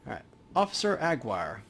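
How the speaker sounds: ordinary delivery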